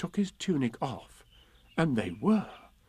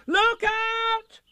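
high warning shout, louder; crying out; 5 dB louder than usual. A narrator's reading. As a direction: warn everyone below. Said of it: "cried Christopher Robin".

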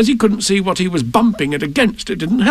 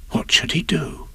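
hushed; 6 dB quieter than usual. A narrator's reading. Piglet wonders anxiously what to do.

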